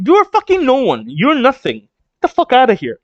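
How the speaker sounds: high, emphatic acted put-down; scornful dismissal. Acting out character's dismissive insult.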